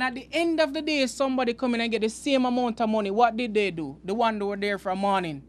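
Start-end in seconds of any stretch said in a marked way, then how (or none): none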